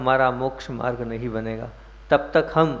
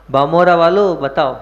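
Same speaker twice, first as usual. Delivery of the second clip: loud, raised call with Bamora वालों stretched; 3 semitones higher, 7 dB louder than usual. Real, not acted. Preacher asks the townspeople for an answer, calling out loudly.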